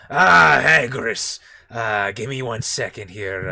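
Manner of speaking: lightly voiced alien shopkeeper; a hint of nasal Toydarian; half-hearted Watto voice. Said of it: "I'm, like, half-heartedly going for it".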